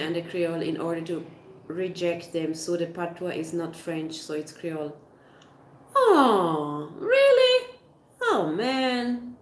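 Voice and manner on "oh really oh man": really leaps far higher and louder; a startled, rising Oh, really?; surprised. Real, not acted. Reacting to the news about the word Creole, shocked.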